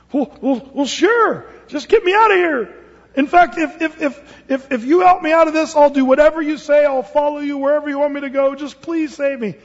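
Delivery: high, stammering desperate bargaining; pleading climber. Acted. Hanging man bargains with God for rescue, pleading. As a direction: promise anything in a high, pleading voice.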